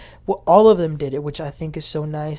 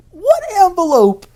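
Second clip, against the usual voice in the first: shocked disbelief; 13 semitones higher, 11 dB louder than usual. Retold or imitated. Accused denies knowing of it.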